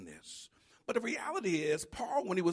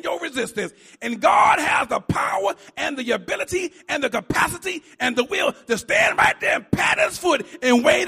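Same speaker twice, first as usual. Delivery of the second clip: loud, fast preacher's run; impassioned gospel preaching; full-force preaching; 7 semitones higher, 11 dB louder than usual. Acted. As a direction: drive it at the congregation. Preacher climax.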